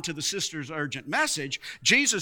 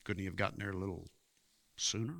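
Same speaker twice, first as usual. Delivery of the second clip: very soft, low murmur; hushed doubt; 11 semitones lower, 15 dB quieter, much faster than usual. Acted. Preacher voices doubt after Lazarus's four days entombed, hushed. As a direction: barely dare to ask it, far under full voice.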